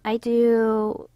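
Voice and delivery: ordinary delivery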